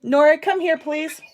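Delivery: high loud call. Real, not acted. Calling child over intercom.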